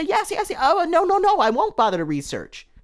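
very high, squeaky mock of a yes-man; mocking sheep voice. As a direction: sneer at the blind followers in a silly high voice. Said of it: "You're saying yes to everything they say".

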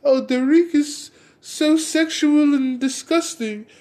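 high, slow mock-prissy scold; mocking prude. Mocking a prude who sex shames him. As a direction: sneer it like a shocked prude. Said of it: "Hell yeah".

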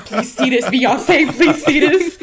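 high, shaking-with-nerves plea, half laughing; hammed-up nervous begging; acted desperate pleading. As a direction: beg the star to notice you. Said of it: "fully me shaking".

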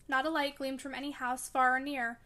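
usual speaking voice

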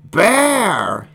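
high, loud creature roar; sudden wild shout; roaring little monster. A narrator's reading. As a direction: roar it out like a baby beast. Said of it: "he roared".

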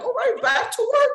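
high, quick put-on voice; retold doctor. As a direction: chirp it fast through a laugh. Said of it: "it's like".

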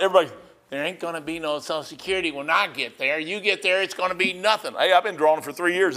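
ordinary talk

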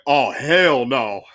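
slow, loud, laughing oh hell no; a buddy's loud laughing refusal, drawn out slow. Retold or imitated. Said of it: "my buddy ... was like".

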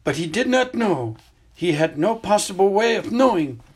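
put-on an old headmaster explaining gently but seriously; Dumbledore. Headmaster tells student about the hidden cave, grave and measured.

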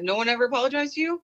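high, quick, rising question; surprised question. Retold or imitated. Teacher asking a student about apologies, in high surprise.

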